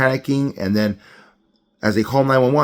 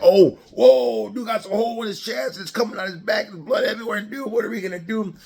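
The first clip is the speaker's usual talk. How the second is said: high-pitched mock panic; freaking out; 11 semitones higher than usual. Imagined medic reacts to a gunshot wound, freaking out. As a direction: squeal it like a hammed-up panicker. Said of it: "They don't freak out".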